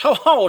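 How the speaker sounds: high loud ho ho cackle; witch cackle. A narrator's reading. Witch cackling on finding the bear, high loud cackle. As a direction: cackle like a pleased old witch. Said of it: "she cackled".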